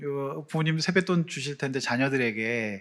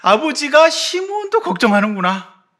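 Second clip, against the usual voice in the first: higher, louder, overcome with emotion; brothers' realization; 10 semitones higher, 6 dB louder than usual. Acted. Preacher voicing Jacob's sons realizing love, higher and moved.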